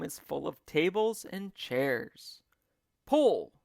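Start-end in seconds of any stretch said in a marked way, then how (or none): 3.1-3.5 loud, high yell. A narrator's reading. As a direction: yell the command. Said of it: "yelled Mugglewump".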